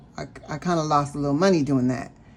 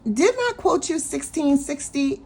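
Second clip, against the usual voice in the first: questioning clerk; 10 semitones higher than usual. Retold or imitated. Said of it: "She said".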